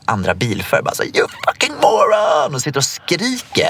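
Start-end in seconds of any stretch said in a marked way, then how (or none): 1.2-2.5 mock road-rage outburst in English; retold road rage yell. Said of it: "han satt och skrek på andra".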